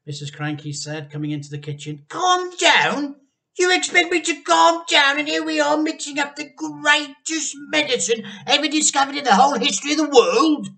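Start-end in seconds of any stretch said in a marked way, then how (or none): 2.1-10.7 very high, loud frantic retort; outraged at being told to calm; manic dad. A narrator's reading. Mister Cranky snapping back at his wife, frantic and loud.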